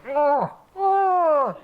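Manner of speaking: put-on high, hooting moose call done by a kid; imitated moose call. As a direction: mimic a small boy's moose call. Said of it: "practicing his moose call".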